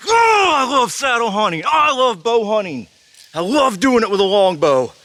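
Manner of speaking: real overjoyed hunter, voice cracking; euphoric shouting. Real, not acted. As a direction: shout your love of the hunt to the woods. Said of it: "I gotta calm down".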